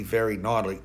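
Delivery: ordinary delivery, nothing marked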